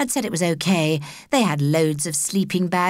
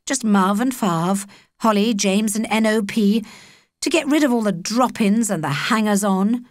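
ordinary delivery

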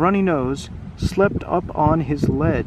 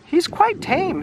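high storybook character voice; Mimi's voice; 12 semitones higher, slower than usual. Acted. Child telling villagers the dragon is tame, high character voice. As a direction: pitch it up to reassure the crowd.

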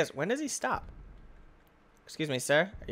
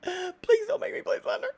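high, soft fake crying; mock whimpering plea; 13 semitones higher, 5 dB quieter than usual. Gamer begging the monster not to make him play, whiny. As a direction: whine like a scared kid.

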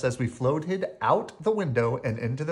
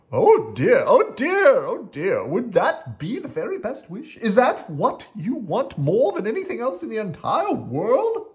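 high, swooping anxious voice; fussy worried storybook character; worried fretting; 9 semitones higher, 6 dB louder than usual. Acted. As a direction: fret loudly, swooping up and down. Worried Rocky questions the flying wish.

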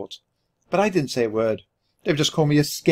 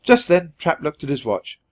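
usual speaking voice

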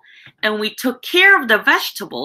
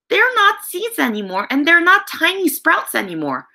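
put-on lifted, higher voice of wonder for the children; storybook excitement, pitched higher; excited wonder; 8 semitones higher than usual. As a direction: sound amazed at how much they've grown. Reading to children, showing wonder.

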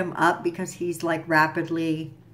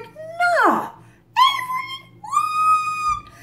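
much higher put-on girly voice of disbelief; mock shocked Abby; 3 dB louder, much slower than usual. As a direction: play the stunned young woman, squeaky and high. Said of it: "And Abby's like".

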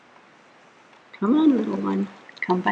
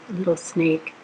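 plain reading voice

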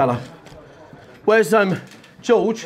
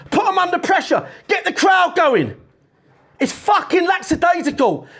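raised, pitched-up rant at his players; angry team talk; 9 semitones higher than usual. Real, not acted. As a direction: lay into the team, voice climbing high.

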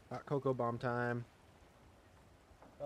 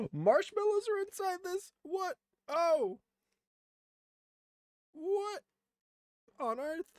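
astonished. Real, not acted. Marshmallows burst out of cocoa.

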